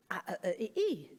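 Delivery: imitated vowel-only speech, jumping up on the last sound; Louis. Parent voicing Louis asking for macaroni cheese, imitated vowel sounds. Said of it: "Or he might say".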